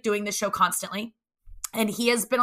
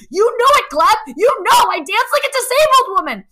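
gleeful; 11 semitones higher, 8 dB louder than usual. Retold or imitated. She playfully calls herself out.

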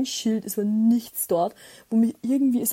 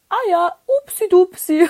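high, slow, louder playful oops; sheepish oops; 9 semitones higher, 10 dB louder than usual. Retold or imitated. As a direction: coo it like a silly apology. Imitating the man's sheepish oops. (verlegenes, hohes, langsames „upsi dupsi“)

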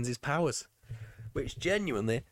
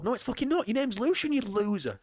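mock angry retort; 11 semitones higher than usual. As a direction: snap back at the impostor, hammed up. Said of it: "Michael, it's Milo".

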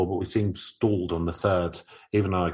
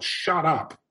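yelled; 9 semitones higher than usual. Retold or imitated. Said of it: "I yelled".

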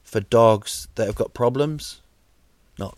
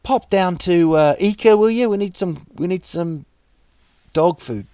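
higher and louder, casual shouted errand; mock owner ordering the dog; 6 semitones higher, 5 dB louder than usual. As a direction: call out to the dog like a lazy bloke on the couch. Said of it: "T V going".

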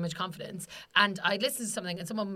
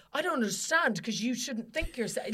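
affronted. Retold or imitated. Someone reacting to a confident woman, mildly affronted. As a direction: act mildly put out and baffled. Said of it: "they're so affronted".

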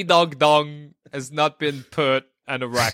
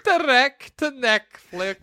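higher, slow ogre voice; Shrek voice; 8 semitones higher, slower than usual. Pretend. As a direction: echo it as Shrek. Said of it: "repeats in Shrek voice".

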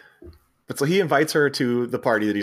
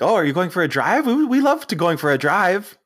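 higher, eager, hammed-up foreign-sounding rich kids; pushy rich siblings; 7 semitones higher, 3 dB louder than usual. Brazilian siblings butting in on Chevy, pitched higher and eager. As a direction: barge in with a brightly pushy invite. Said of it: "the Brazilianaires pop up and they're like".

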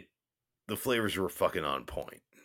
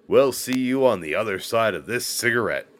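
loud sign-off; 4 semitones higher, 9 dB louder, faster than usual. Real, not acted. Signing off before a break.

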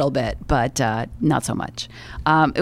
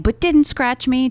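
ordinary delivery

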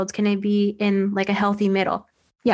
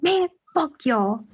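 higher-pitched, drawn-out mock defiance; retold defiant comeback; 6 semitones higher, slower than usual. Actor telling off his scornful film-school classmates, retold defiant comeback. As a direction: act out a fed-up comeback, pitched up and slow.

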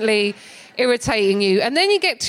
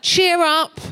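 street man quote again; 9 semitones higher than usual. Retold or imitated. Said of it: "I've started saying".

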